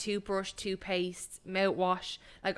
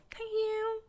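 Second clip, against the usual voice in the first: squeaky thank you. Retold or imitated. Delighted to get a bottle.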